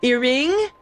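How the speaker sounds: Earring with ee-ring, rising in disbelief; mispronounced name. Retold or imitated. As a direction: say Earring as a puzzled question. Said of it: "people who called me Earring".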